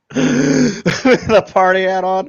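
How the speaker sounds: loud, high laughter running into the words; laughing hard. Real, not acted. Artist cracking up at own silly drawing, laughing. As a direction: really crack up while talking, drawing out the words.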